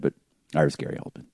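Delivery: normal talking voice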